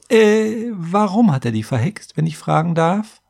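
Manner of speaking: high, polite interrupting question; hesitant interjection. A narrator's reading. Scribe interrupts the warrior's tale, politely probing. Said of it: "hakte ich ein".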